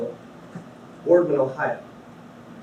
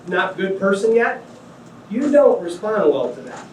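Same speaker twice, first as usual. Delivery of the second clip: ordinary talk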